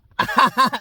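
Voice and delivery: high, squealing mock cackle; imitated cackle. Imitating Jake's cackle for the viewers, high mock cackle. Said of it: "he has this fucking cackle".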